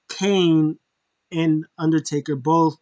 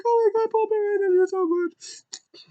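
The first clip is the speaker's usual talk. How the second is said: emotional crying fan. Retold or imitated. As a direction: gush through tears over the hologram. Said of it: "I'm like".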